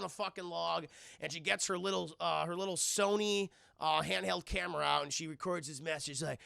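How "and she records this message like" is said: ordinary talk, nothing marked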